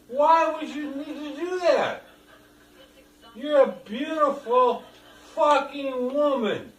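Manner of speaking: high, loud, insistent ranting; angry ranting. Real, not acted. Dad yelling during argument.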